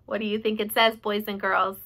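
put-on reading to children aside; teacher to class. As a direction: lively question to children.

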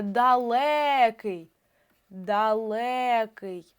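ДАЛЕКИЙ very slowly, Е held long; наголос на Е. Shown as a demonstration. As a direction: stretch the stressed Е in ДАЛЕКИЙ. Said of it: "принцип перевірки наголосом". (ДАЛЕКИЙ з протяжним наголошеним Е)

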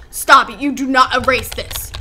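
usual speaking voice

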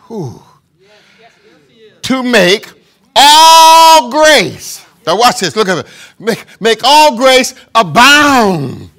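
preacher swelling on "all grace"; fervent scripture reading. Acted. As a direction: proclaim the verse slowly. Reads verse with fervor.

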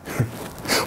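breathless unvoiced giggle; nervous laugh. Madman breaking into a breathy giggle. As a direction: let a mad little laugh escape.

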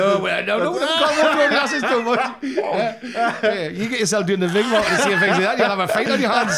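loud, high laughing protest. Real, not acted. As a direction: laugh hard while protesting. Protesting while laughing hard.

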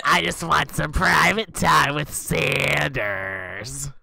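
drawn-out joking shout; loud and slow, hamming it up; slow teasing shout. Real, not acted.

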